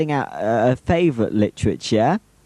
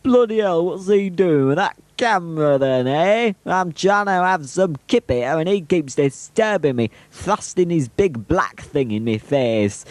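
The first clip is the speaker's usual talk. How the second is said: higher, put-on grumbling dog character; imagined dog's voice; 5 semitones higher than usual. Filmer voices dog disturbed from kip, mock-disgusted higher voice. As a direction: voice the dog's grumpy thoughts, pitched up. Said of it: "she's thinking".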